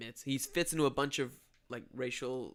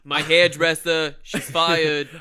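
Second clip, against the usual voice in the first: slow, louder mock Trump firing the hairdresser; Trump impression; 8 dB louder, much slower than usual. A co-host firing his hairdresser in a slow, loud Trump voice. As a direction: drag it out like a blustering boss. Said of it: "your Donald Trump accent".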